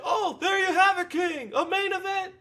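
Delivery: hammed-up ringside call to King; Jim Ross. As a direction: yell it like a wrestling commentator. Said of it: "and Jim Ross is going".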